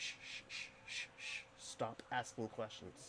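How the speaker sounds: whispered shushing. Retold or imitated. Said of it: "she just keeps".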